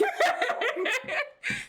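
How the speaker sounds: high rising giggle; high laugh. Real, not acted. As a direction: laugh off the sponsor joke. Laughing at sponsor joke.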